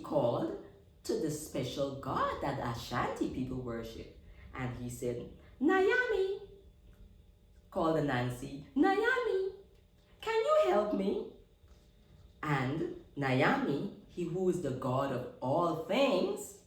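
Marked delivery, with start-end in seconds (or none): 5.6-11.3 high, louder call to the sky. Acted. Anansi calls the god Nyame for help, high call. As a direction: call up to a god far away.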